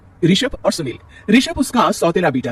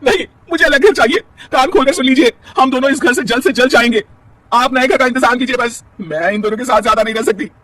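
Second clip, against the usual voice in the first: tearful demand; 8 semitones higher, 6 dB louder than usual. Acted. Wife demands separate house.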